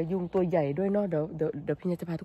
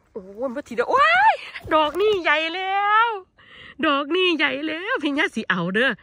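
excited; 7 semitones higher, 3 dB louder than usual. Real, not acted. Spots a big mushroom.

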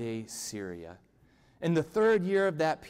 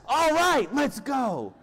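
very high, loud burst of excitement; hammed-up whoop of readiness; gleeful battle cry; 10 semitones higher, 6 dB louder, slower than usual. King gets the answer he wants, cheering excitedly.

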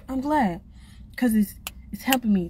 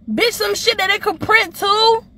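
loud rant; 10 semitones higher, 5 dB louder than usual. Real, not acted. Ranting about money printing.

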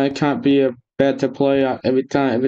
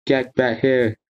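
ordinary talk, nothing marked

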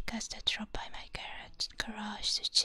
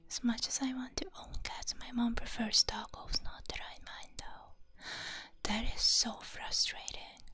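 ASMR whisper. Real, not acted. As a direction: whisper gently as if not to wake anyone.

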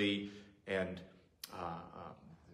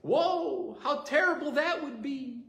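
much higher fussing grandma voice; grandma's worried voice; 12 semitones higher than usual. Retold or imitated. Grandma warning grandchild not to drive in snow, much higher fretting voice. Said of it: "she would say like this".

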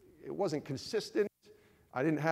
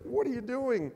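upset wife; 7 semitones higher than usual. Retold or imitated. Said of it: "But she's like".

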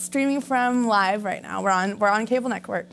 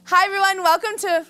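show host intro; 6 semitones higher than usual. Acted. Host greeting viewers at the top of her show, grand intro. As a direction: sing out the show's name brightly, pitched high.

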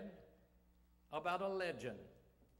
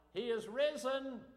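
shouted high and loud, triumphant; 7 semitones higher, 5 dB louder than usual. Retold or imitated. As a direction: proclaim it at the top of your lungs. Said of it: "as loud as he could shout it".

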